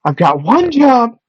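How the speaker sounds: mock announcer voice. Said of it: "It's stupid, but I'm gonna do it".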